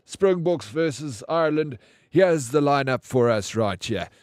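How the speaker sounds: hammed-up pregame commentary; Hugh Bladen commentator. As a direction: roll it out like a TV match host opening the broadcast. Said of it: "That's Hugh Bladen".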